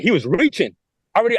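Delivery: usual speaking voice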